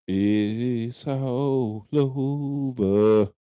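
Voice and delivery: very slow, stretched gloating; drawn-out taunt. Real, not acted. To Cowboys fans, very slow gloating drawl. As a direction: stretch every word to rub it in.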